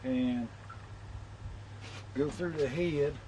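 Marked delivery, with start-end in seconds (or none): none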